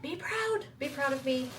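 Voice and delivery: mock-pleading to husband Jesse, high; playful plea. Real, not acted. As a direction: beg him to be proud, teasing.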